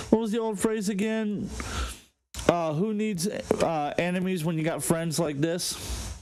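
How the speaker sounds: ordinary talk, nothing marked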